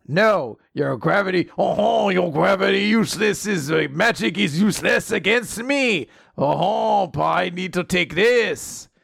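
sing-song, hammed-up French villain; French accent. [name] dismissing Lance's magic in a duel, hammy French accent. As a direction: boast in a silly French accent. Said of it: "is apparently very French".

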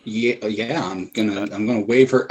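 usual speaking voice

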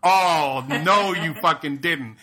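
loud, slow, drawn-out "oh no you didn't"; sassy mock offense. Retold comeback to insult.

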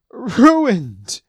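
high, loud anguished wail; bawling. Acted. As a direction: howl over the ruined stew. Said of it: "bawled Gambo uncontrollably".

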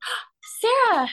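high, dramatic imitated indignation; mock offence. Artist retells costar not being drawn, mock-insulted and high.

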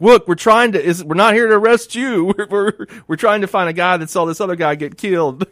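mock yell that eases off toward the end; retold yell. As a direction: reenact yelling to calm a standoff. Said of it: "and I'm yelling".

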